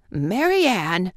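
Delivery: lightly voiced fussy rabbit calling out, slow. A narrator's reading.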